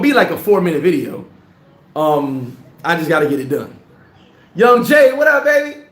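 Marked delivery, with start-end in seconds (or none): 4.5-5.8 high, loud hype shout-out; hyped greeting. Real, not acted. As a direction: call it out like a hype man.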